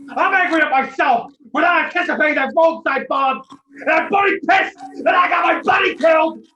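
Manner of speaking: angry shout. Acted. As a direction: explode with guilt and rage. Scott erupts about dead buddy.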